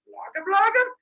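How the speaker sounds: very high, loud querying babble; questioning monster gibberish. Retold or imitated. Monsters asking about the agreement, high questioning gibberish. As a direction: jabber it as a rising monster question. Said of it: "I don't speak monster".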